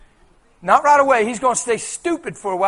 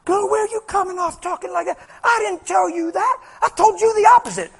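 much higher, rising to louder mocking imp voice; a cheeky demon scolding the believer, much higher; a little imp scolding the girl in a much higher voice; sassy little imp; 10 semitones higher, 4 dB louder than usual. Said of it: "That little imp that lied to you sitting there going".

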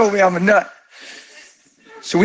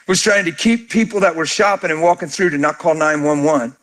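usual speaking voice

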